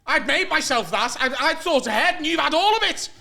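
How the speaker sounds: loud, high, aggrieved flatmate; mock angry rant. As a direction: accuse in mock outrage. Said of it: "he's proper screaming at me".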